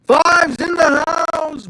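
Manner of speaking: slow, stretched, high announcer shout; hype shout. Acted. Welcoming a viewer onto the stream with a stretched hype shout. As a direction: call it out like a ring announcer.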